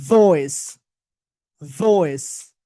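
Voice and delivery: "ভয়েস" with a long vibrating v; buzzed V demo. Shown as a demonstration. Teacher gives a word for V to repeat, buzzing the v. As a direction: lean hard into the v of "ভয়েস". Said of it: "প্রথম বলেন".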